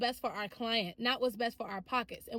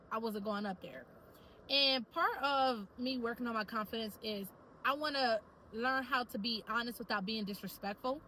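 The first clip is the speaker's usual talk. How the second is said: ordinary talk, nothing marked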